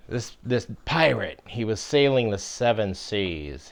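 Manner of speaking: ordinary talk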